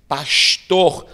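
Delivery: "Pastor" with a hissed sh before the t, "pashtor"; carioca sh. Retold or imitated. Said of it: "tirar do carioca o som de sh".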